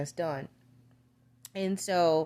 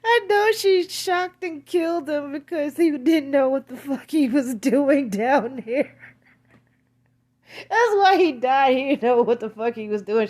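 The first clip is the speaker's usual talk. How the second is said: laughing outburst; 5 semitones higher, 7 dB louder than usual. Real, not acted. Laughing outburst over the death.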